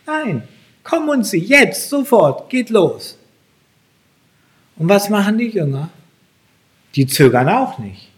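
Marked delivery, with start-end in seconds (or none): 0.1-3.2 high-pitched, insistent call to act now; pressing, raised-pitch command; urgent summons. Acted. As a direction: urge them to move at once. Urgent command against mock excuse.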